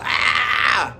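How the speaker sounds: loud high mock yelp of pain; mock pain cry. Showing how Daniel would roll about, loud high mock yelp. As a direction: yelp as if clutching a hurt foot.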